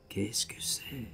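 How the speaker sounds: slow, dry whisper, throat closing; choked voice. A narrator's reading. Narrator choking on his words to the stranger, slow whisper. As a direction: force it out through a dry throat. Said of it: "Je me force, ça me sèche dans le gosier".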